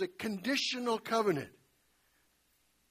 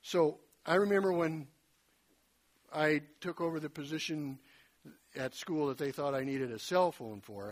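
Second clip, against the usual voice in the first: normal talking voice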